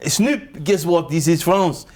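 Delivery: coaxing comic French caller; French accent. Pretend. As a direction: pitch it as a mock French official. Plays French official phoning [name].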